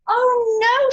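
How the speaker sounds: high alarmed shriek. A narrator's reading. George spots a falling chick, shrieking high and loud. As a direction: cry out in fright. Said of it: "shrieked George".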